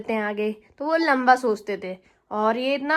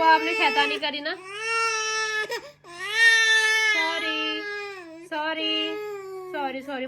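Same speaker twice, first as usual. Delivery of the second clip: tearful apology; 6 semitones higher than usual. Real, not acted. Mother tearfully says sorry to her scolded baby, high and teary. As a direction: apologize through tears. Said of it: "guilt feel हो रहा है".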